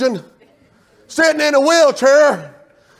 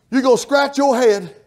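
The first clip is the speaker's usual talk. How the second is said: ordinary talk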